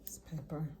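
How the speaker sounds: a quick whispered aside. Real, not acted. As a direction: breathe the words out with no voice. Quiet aside while seasoning.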